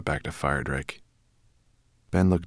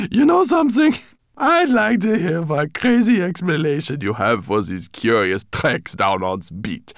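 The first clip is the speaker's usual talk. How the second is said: high, loud, mocking fat professor; 8 dB louder than usual. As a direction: gloat over the find. Rosenberg mocking, laughing loudly.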